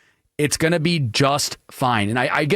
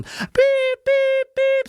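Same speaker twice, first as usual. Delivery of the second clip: high flat electronic beeps, much higher; fire alarm beep imitation; much slower than usual. Host mimicking wife's smoke alarm, high flat beeps.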